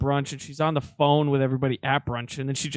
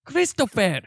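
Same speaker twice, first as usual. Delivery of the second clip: high, loud aunt impression; imitating loud aunt; 8 semitones higher, 6 dB louder, slower than usual. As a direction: call out like an exasperated aunt. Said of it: "your aunt sounds like that".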